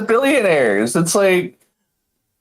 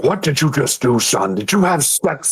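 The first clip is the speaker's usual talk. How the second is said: dad. Retold or imitated. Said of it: "he's like".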